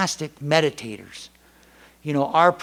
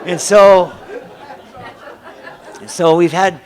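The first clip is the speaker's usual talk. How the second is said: laughing; 5 semitones higher, 13 dB louder, slower than usual. Real, not acted. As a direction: still laughing, louder. Laughing after the story.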